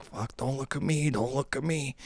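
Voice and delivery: hushed pleading under breath; desperate whisper. Retold or imitated. Student hiding from the teacher, hushed pleading. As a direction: plead under your breath to stay unseen. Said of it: "you're like".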